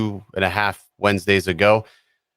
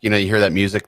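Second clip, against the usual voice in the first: ordinary talk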